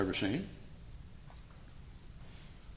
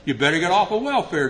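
scolding; 7 semitones higher, 7 dB louder than usual. Retold or imitated. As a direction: lay it on him bluntly. Sponsor Norm scolding Johnny.

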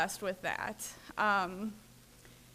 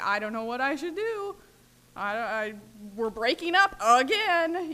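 distressed plea; 6 dB louder than usual. Retold or imitated. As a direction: cry out the breakup in a panic. Frantic calls to her sponsor.

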